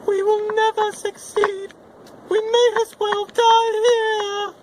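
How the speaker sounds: high, slow, tearful cry; despairing wail. Acted. Buttercup to Wesley in the swamp, high tearful despair. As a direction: whimper it out, losing all hope.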